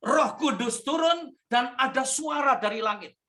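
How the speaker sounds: slow, lifted proclamation of scripture; preacher reading the gospel grandly; solemn scripture reading. Acted. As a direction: proclaim it with awe, slowly. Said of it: "Kata firman Tuhan begini".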